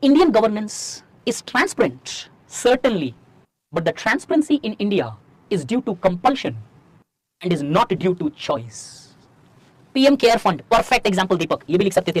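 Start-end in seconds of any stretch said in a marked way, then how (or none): 0.1-9.1 very slow, word-by-word delivery; slow dictation. Shown as a demonstration. Teacher dictating a key line for students to copy, slowly. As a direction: say it slowly so they can write every word. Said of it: "अच्छा, repeat करूं".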